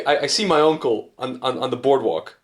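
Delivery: ordinary delivery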